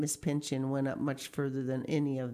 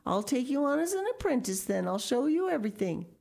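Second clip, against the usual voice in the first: high, quick delighted promise; pleased offer; 9 semitones higher, faster than usual. A narrator's reading. Miss Pinchin takes Lucy as apprentice, pleased offer. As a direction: offer it brightly and eagerly. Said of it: "quite pleased".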